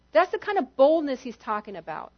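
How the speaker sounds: ordinary talk, nothing marked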